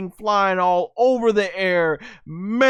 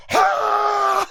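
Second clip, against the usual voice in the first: delighted laugh. Real, not acted. Cheering Goku's trick, breaking into high laughter. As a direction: crack up with glee.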